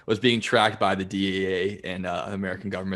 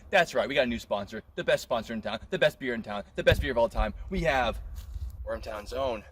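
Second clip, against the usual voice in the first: mock ad read; 3 semitones higher, 3 dB quieter than usual.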